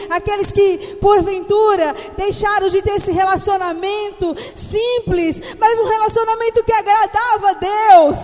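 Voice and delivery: loud, fervent preaching over music. Acted. Pastor preaching to the church, fervent and loud. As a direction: proclaim it with full fervor.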